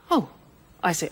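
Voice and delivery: ordinary delivery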